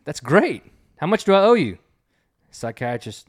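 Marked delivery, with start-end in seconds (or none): 0.0-1.8 eager patient. Acted.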